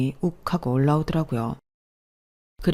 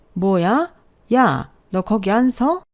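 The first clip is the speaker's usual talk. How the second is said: higher, drawn-out mock yell; mother-in-law's yell; 6 semitones higher, 4 dB louder, much slower than usual. A narrator's reading. Mother-in-law yelling at the departing daughter-in-law, moderately raised yell. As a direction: call after someone walking off, moderately raised voice. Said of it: "시어머니가 고함을 지르던 말던".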